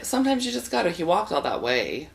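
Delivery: ordinary delivery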